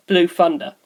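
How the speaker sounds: plain reading voice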